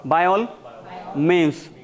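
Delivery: BY ALL, repeated, then MEANS after a pause; feed BY ALL MEANS to the class in pieces; chunked drill. Shown as a demonstration. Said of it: "You should say in reply".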